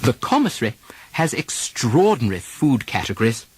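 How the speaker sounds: British RP model sentences, -ary vowels dropped. Shown as a demonstration. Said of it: "Try it in these sentences".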